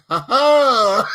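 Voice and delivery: mock taunting laugh; mocking laugh quote. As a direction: taunt with a sharp ha ha. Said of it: "She said".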